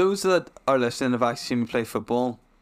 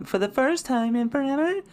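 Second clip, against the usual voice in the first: mock dramatic; 7 semitones higher, slower than usual.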